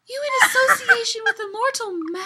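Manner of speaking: mock him with a giggle; teasing laugh. Elf teasing Loki about the mortal, laughing.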